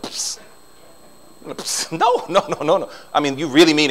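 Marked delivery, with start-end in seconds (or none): 0.0-1.9 spit sound. Shown as a demonstration. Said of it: "that little spit thing".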